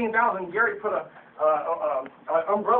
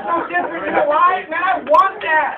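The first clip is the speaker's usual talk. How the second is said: much higher, loud, slow, fired-up preaching; excited; 10 semitones higher, 11 dB louder, slower than usual. Real, not acted. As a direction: build to a rally shout. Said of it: "I get excited".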